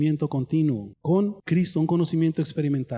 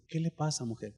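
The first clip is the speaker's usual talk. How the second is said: low, soft question; a softly spoken concern; gentle question; 3 semitones lower, 9 dB quieter than usual. Retold or imitated. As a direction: ask her kindly.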